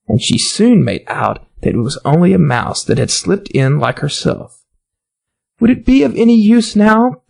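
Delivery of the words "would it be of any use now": higher, lightly voiced girl; a young girl fretting, lightly played; Alice's voice. A narrator's reading. Alice's voice in story.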